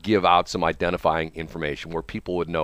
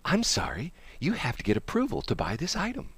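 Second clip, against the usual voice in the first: robot checkout voice; 7 semitones higher, 7 dB quieter than usual. Retold or imitated. Machine voice retold.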